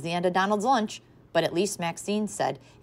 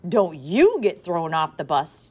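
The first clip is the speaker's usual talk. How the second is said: louder, slow stern warning; mother warning her child, stern and loud; 8 dB louder, slower than usual. A narrator's reading. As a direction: lay down the law.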